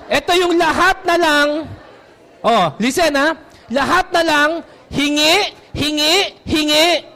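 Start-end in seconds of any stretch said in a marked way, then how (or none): 4.9-7.0 mocking beggar. Mocking constant asking.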